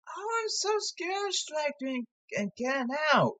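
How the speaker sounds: high, soft mock-timid whine; squeaky high mocking voice of a nervous hitter; scared player. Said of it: "People don't wanna bunt because".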